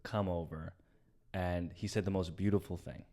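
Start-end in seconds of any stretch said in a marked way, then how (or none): 0.0-0.7 softly, after a calm pause; a quiet, settled invitation. Retold or imitated. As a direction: brother calming down after the fight, quietly. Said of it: "after, like, a calm pause".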